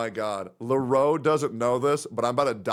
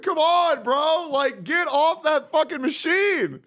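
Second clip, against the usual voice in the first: high, louder, drawn-out exasperation; exasperated pleading; 3 dB louder, slower than usual. Retold or imitated. Begging a friend to leave his computer, exasperated. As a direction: plead loudly, at your wits' end.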